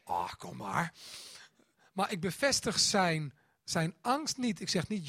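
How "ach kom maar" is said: much lower, soft "come on then"; father letting his scared son into bed, tender; tender giving in. Retold or imitated. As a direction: gently let the boy in. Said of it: "En dan zeg ik soms".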